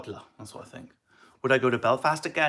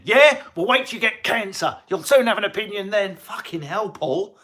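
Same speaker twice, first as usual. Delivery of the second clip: shouty mock outrage, higher; mock rant; 8 semitones higher, 7 dB louder than usual. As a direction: bark back at a commenter, high and loud. Mock angry reply to a commenter.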